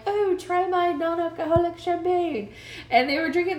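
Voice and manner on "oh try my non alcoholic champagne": mock host. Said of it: "he was like".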